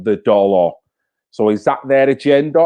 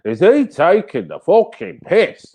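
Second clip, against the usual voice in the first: higher, indignant mock Brummie; imitated Brummie accent; 6 semitones higher, 3 dB louder than usual. Imagined Brummie reacting to the impression, mock-indignant Brummie accent. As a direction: play an affronted local in Brummie. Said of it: "someone from Brummie will go".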